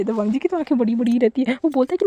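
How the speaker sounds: ordinary delivery, nothing marked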